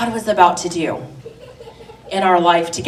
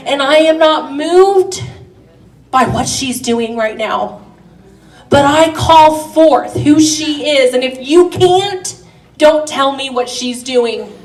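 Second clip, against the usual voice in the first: loud, fervent preaching; 5 semitones higher, 7 dB louder than usual. Acted. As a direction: build it like a revival sermon. Preaching at full force.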